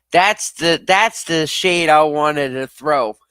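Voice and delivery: loud, high, drawn-out exclamation; tickled, slow and loud delight; delighted outburst. Real, not acted. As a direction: crow it out between bursts of laughter. Cracking up at viewer's joke.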